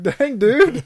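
amused "Dang, dude" through a laugh; a laughing, impressed jab; laughing quote. Retold or imitated. Reacting to a friend's self-burn, laughing.

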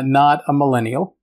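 ordinary talk, nothing marked

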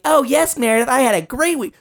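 sing-song imitation of an upbeat coworker; mock coworker. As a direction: mimic a fake-cheery colleague. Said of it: "stop responding to her being like".

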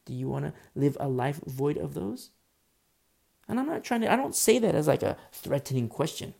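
ordinary talk

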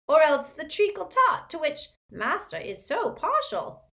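high fawning elf voice; servile house-elf. Acted. Elf offering his master dinner, high and fawning. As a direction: simper it out like a groveling elf.

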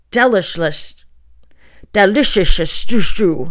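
loud hissed repetition, trailing off; old book hissing out its recipe. A narrator's reading. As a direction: hiss the words like rustling paper. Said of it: "making every sound hiss".

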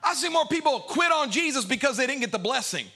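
ordinary delivery

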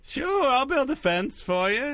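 very high, coy clown voice; high Yiddish clown character; Doctor Mindbender. Acted. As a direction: squeak it out as an obliging clown. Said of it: "The Walking Dead and Doctor Mindbender".

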